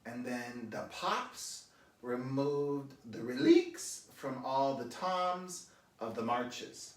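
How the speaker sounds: imitating the guide's slow, mangled foreign English; drawn-out foreign accent with misplaced vowels; mock German-accented lecture, slowly; German tour guide accent. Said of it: "she says".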